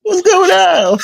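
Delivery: high, teasing imitation of a smooth playboy; mock playboy voice. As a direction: parrot his smooth greeting way up high.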